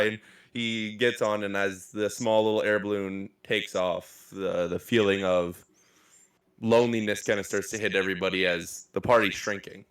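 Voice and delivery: ordinary delivery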